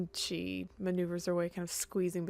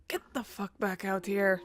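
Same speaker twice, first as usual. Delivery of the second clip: half-raised character shout; mild acted shout; 4 dB louder than usual. Sarah ordering Vega back out, mild acted shout. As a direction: call out roughly, without a full yell. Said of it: "Sarah's just kind of shouts at Vega".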